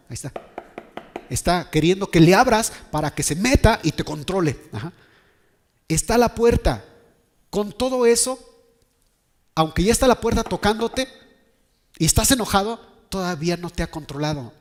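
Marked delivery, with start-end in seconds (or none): 1.3-12.8 loud, high-pitched sermon warning; forceful preaching. Acted. Preacher warning that sin is knocking at the door, forceful.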